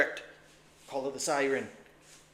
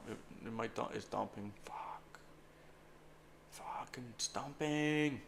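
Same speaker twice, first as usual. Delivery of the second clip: whispering; 8 semitones lower, 15 dB quieter than usual. Real, not acted. Trader watching his position dump, whispering. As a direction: whisper it as the price falls.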